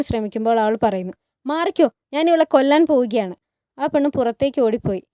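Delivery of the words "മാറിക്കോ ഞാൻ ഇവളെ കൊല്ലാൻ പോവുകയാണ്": louder, higher acted rage; furious threat. Hurrem ordering a servant away mid-fight, furiously. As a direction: warn them off with a threat.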